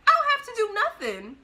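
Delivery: high, loud retort; mock yell at the lady; defiant shout. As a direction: fire it back high and loud. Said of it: "That's exactly what I told her".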